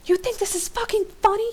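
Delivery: very high-pitched furious yell; angry yell. A narrator's reading. Hero yelling at giggling Rick, angry yell. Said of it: "you yell at him".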